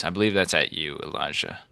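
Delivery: normal talking voice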